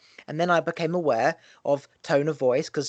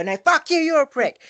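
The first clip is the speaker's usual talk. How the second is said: much higher, louder furious shout; shouted back; 12 semitones higher, 6 dB louder than usual. Retold or imitated. Shouting the insult back, reacting in kind. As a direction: hurl it straight back, yelling. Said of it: "you go".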